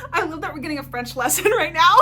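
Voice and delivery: high, loud, giggly gushing; delighted laughter. Real, not acted. Reader getting a French lesson from her friend, delighted laughter. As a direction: laugh through it, bubbling over.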